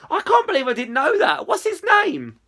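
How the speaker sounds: high, eager burst of delight; excited friend. Acted. Izzy to friend on learning of the dog, excited. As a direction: let the pitch leap with excitement.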